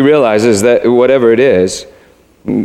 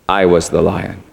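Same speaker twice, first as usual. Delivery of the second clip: low, grave lion's reveal; large voice; 7 semitones lower, 4 dB quieter than usual. A narrator's reading. Unseen lion revealing himself to Shasta in a large voice. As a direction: drop low and state it simply. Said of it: "said the large voice".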